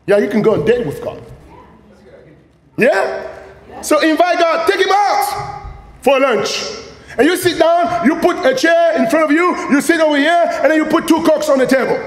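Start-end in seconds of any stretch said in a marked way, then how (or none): 2.8-12.0 excited. Real, not acted. Energetic preaching.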